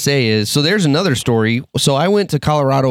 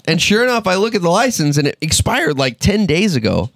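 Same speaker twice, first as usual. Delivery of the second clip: ordinary talk, nothing marked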